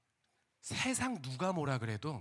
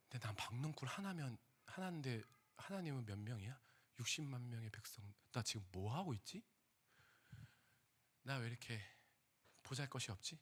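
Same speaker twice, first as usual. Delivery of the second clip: much softer, murmured to himself; quiet realization; 3 semitones lower, 11 dB quieter than usual. Retold or imitated. Jonah quietly realizing his pettiness, murmured. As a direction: let it dawn on you softly.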